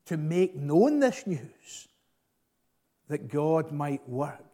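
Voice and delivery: normal talking voice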